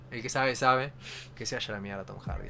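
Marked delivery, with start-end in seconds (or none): 1.4-2.5 muttered low and soft; cursing under breath. Real, not acted. Muttering the curse at Tom Hardy.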